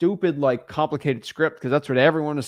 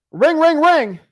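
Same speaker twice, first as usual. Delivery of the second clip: much higher, louder ring ring; phone ring imitation; 12 semitones higher, 9 dB louder, slower than usual. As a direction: chirp out a ringing phone.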